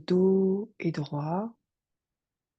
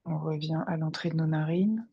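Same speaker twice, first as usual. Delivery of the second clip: guided meditation. Acted. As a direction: guide them gently into their breath. Guide une méditation. (voix de méditation guidée, calme et posée)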